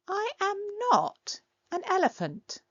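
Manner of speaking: a tiny creature insisting it is no elephant; squeaky indignant denial. Acted. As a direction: protest in a high small voice. Character denies being elephant.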